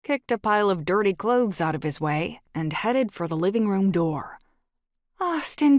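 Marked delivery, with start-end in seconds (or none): 5.2-5.8 shouted high and loud, stopping him; shouting his name. Acted. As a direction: call out Austin sharply, raised voice. Tracy yells at him leaving.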